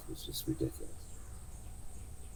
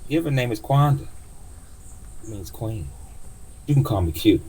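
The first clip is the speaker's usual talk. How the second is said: higher, louder proud queen; old cab driver; 5 semitones higher, 5 dB louder than usual. Acted. Driver telling passenger her name, high proud voice. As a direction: announce the name with pride.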